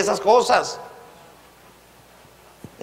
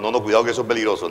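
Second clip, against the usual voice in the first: quick, low aside as a worrier; imitating cautious objectors; 8 semitones lower, much faster than usual. Some people warning that this is dangerous, quick imitated caution. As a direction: rattle off a mock warning. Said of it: "ay, que algunos digan".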